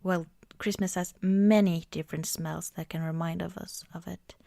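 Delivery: normal talking voice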